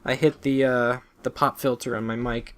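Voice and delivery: ordinary talk, nothing marked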